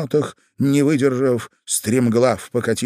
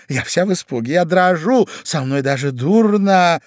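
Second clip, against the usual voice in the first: affected fright; 7 semitones higher, 5 dB louder than usual. Acted. Lady claims fright and trembling.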